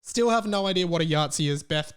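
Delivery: usual speaking voice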